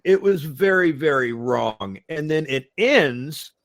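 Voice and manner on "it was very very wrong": usual speaking voice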